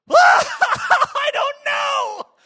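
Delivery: shrieking high through a burst of laughter; acted hysterical laughing shout; uncontrollable laughing. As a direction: howl the words out helplessly, stretched long and very loud. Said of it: "I'm uncontrollably laughing".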